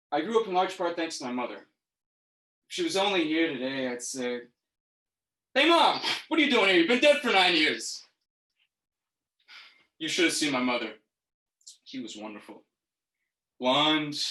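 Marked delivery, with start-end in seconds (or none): 5.5-8.0 high, loud, incredulous exclamation; comic outburst of disbelief; startled call to Mom. Acted. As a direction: blurt it out as if Mom just walked in. Acted greeting to dead mother.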